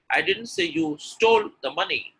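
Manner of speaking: "stole" high and held; stress on stole. Shown as a demonstration. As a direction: punch and stretch "stole". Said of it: "When I'm stressing on stole".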